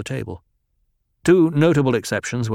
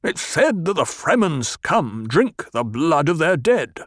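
slow, higher, sneering anger; angry; 6 semitones higher than usual. Acted. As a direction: spit out the insult with slow contempt. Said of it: "spoke in an angry voice".

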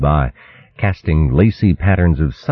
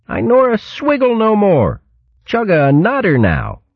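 put-on much higher, childlike babble; tiny squirrel lisping proudly; baby squirrel voice. A narrator's reading.

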